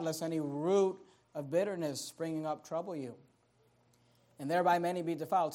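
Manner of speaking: low, soft preaching voice; solemn warning. Real, not acted. Preacher warning the church about bitterness, solemn and hushed. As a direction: bring it right down and warn gravely.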